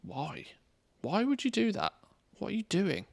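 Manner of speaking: high, incredulous why; baffled at the game's choices; exasperated disbelief. Real, not acted. Exasperated at the game.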